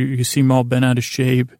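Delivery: usual speaking voice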